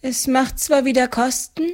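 put-on lightly relieved, high elderly woman; relieved older woman. A narrator's reading. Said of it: "Die Frau atmete erleichtert auf".

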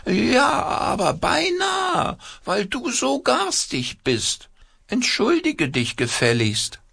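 very high, slow, wailing puppet scolding; indignant puppet voice. A narrator's reading. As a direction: shriek at the other puppet in outrage.

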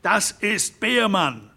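high, slow cry of alarm; shouted. Acted. Derrick announcing the railway man to the ranchers, crying out. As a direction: call it out to the room. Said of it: "schrie Harron Derrick".

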